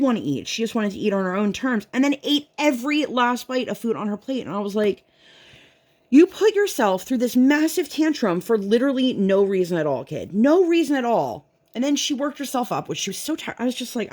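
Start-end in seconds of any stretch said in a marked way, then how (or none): 6.1-11.4 exasperated scolding. Retold or imitated. Mother scolds daughter after tantrum.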